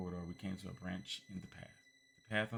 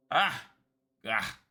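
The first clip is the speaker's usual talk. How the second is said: loud high scream of horror; horrified cry; 6 semitones higher, 12 dB louder than usual. Acted. Old man recoiling from Kid, horrified scream. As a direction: recoil with a shriek. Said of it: "recoiled in horror".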